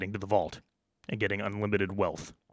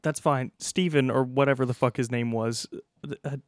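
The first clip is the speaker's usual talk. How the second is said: higher, stammering first-day worker; nervous new guard; 7 semitones higher, 4 dB louder than usual. Acted. New vault guard on his first day, worried and higher-pitched. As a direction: fumble the words like a worried rookie guard.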